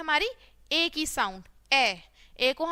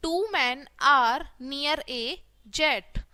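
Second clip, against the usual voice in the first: very slow, separated words; word-by-word reading; much slower than usual. Shown as a demonstration. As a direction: read it out slowly for beginners. Teacher models reading word by word. (धीरे-धीरे, एक-एक शब्द पढ़ना)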